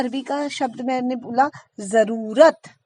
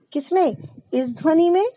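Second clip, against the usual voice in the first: normal talking voice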